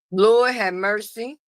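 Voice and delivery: ordinary talk, nothing marked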